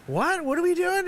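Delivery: high-pitched, incredulous questioning; mock bewilderment; baffled reply. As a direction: ask it in bewildered disbelief, voice jumping up. Retelling own baffled reply to Hammer.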